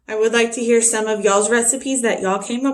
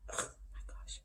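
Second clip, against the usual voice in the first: barely voiced, breathy whisper; whispered aside; 24 dB quieter than usual. Real, not acted. Owner telling viewers how wild her dog is outside, whispered. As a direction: whisper it under your breath.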